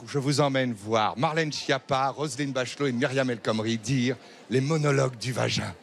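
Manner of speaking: ordinary talk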